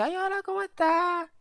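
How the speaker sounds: high, sugary greeting, mock polite; sing-song hello from a clown mom; mother's greeting. Girlfriend's mother greeting the boyfriend, high mock sweet. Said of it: "Me dice".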